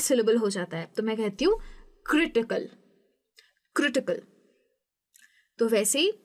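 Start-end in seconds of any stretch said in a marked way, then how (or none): 2.1-4.2 "critical" with CRI firm, ending light; CRItical, front-stressed. Shown as a demonstration. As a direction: hit CRI in "critical", let the rest fall. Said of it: "CRI है वो ही हमारा".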